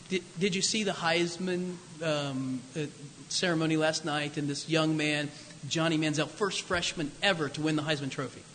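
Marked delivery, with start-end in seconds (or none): none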